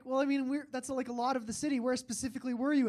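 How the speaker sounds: pressing cashier impression. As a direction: push the question as an imitated cashier.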